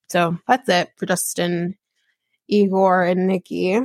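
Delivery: ordinary talk, nothing marked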